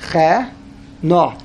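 CHE, a short stop, then NO; slight pause. Shown as a demonstration. Teacher showing the pause between chaf and nun, slightly paused. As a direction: break briefly between Che and no. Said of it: "supposed to pause very, very slightly".

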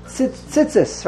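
tzitzis squashed to "tsits-ss", short vowels; chirik like shva. Shown as a demonstration. Teacher mimicking a common mistake, short-vowelled tzitzis. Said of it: "That's really wrong".

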